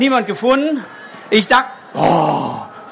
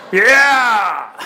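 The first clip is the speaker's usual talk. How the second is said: cheering shout; 2 semitones higher, 4 dB louder than usual. Real, not acted. Kids cheering.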